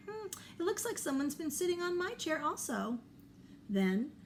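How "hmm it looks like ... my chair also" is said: higher, quicker mother bear voice; light, high mama bear complaining. Acted.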